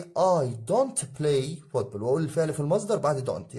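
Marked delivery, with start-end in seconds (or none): 0.0-2.0 slow demo sentence. Shown as a demonstration. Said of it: "هنا بقى هقول إيه".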